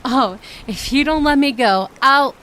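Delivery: loud indignant sputter; flustered threat. Acted. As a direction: bluster a threat you can't back up. Flustered threat while held.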